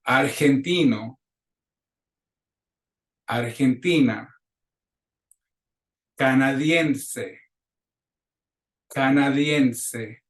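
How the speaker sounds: slow, carefully drawn-out Spanish words; deliberate classroom pronunciation; repeat-after-me drill. Shown as a demonstration. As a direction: model each word for the class to copy. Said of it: "clases repitan".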